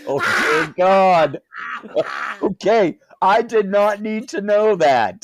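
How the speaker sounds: loud, high, comic dismay; appalled outburst. Real, not acted. Reacting to the ass crack pictures, appalled outburst. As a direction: cry out as if told too much.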